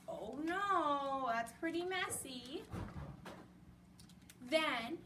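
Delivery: usual speaking voice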